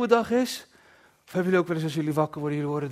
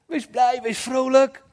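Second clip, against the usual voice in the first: louder and higher, full of joy; joyful call; 7 semitones higher, 7 dB louder, slower than usual. Acted. Preacher reads joyful Bible call. (vrolijk en luid uitgeroepen)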